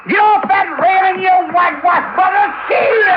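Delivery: high, loud shout at birds; yelling high and loud to scare gulls away; shooing the gulls. Acted. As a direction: holler them off the railing. [name] chasing seagulls off railing.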